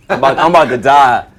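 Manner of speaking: high, very loud overwhelmed gush; starstruck outburst. Retold or imitated. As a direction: blurt it out, overwhelmed at seeing an idol. Starstruck at seeing [name] in audience.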